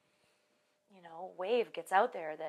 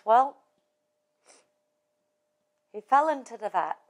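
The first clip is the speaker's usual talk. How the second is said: put-on lightly done Irish accent, louder punchline; 8 dB louder than usual. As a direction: deliver the punchline in a mock Irish brogue. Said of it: "I don't do an accent very well".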